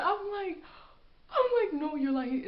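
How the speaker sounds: high, loud, cracking into laughter; laughing hysterically. Real, not acted. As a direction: squeal it through helpless laughter. Laughing hysterically while retelling.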